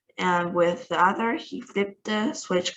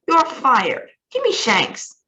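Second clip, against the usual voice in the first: louder and higher, barking orders; shouting; 6 semitones higher, 5 dB louder than usual. A narrator's reading. Ground control firing the astronaut, shouting. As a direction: fire him on the spot at full volume. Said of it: "shouted ground control".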